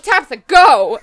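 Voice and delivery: loud retold command; urgent order. Relatives send Tabitha out of the wedding, urgent order. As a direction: order her out, loud and firm.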